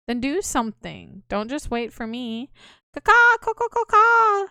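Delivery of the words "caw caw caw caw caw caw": high loud bird squawks; shrieking caw-caw bird call; crow cawing. Acted. Imitating a panicking bird.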